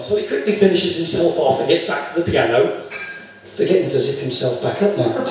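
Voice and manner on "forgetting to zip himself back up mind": usual speaking voice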